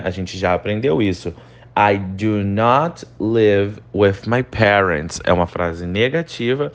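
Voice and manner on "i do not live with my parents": slow, careful full form with NOT; teacher modelling the long form. Shown as a demonstration. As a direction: spell out do NOT slowly. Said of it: "a gente pode fazer a forma contraída".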